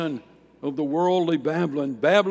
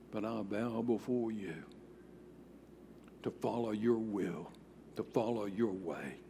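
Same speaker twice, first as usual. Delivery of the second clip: quiet prayer; 4 semitones lower, 10 dB quieter than usual. Shown as a demonstration. Prayer voiced softly in sermon.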